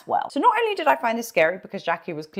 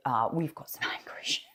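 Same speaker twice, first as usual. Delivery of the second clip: dry whisper with a small laugh, fast; whispered aside; 9 semitones lower, 7 dB quieter, faster than usual. Real, not acted. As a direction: lean in and whisper the joke aside. Wry aside about the rant.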